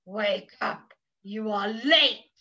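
low, slow big-cat voice; roaring lions and tigers. Acted. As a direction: growl it low like a storybook lion. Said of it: "roared the lions and tigers".

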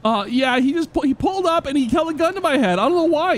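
ordinary delivery

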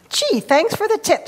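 high, lightly voiced eager turtle; mildly excited thanks. A narrator's reading. Snapping turtle thanks grandpa for the tip, brightly eager. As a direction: lift the pitch as a grateful turtle.

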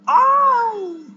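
loud, high drawn-out cry; frustrated yell. Real, not acted. Player urging on the neighbor, frustrated yell. Failing during the game.

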